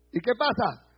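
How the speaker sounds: normal talking voice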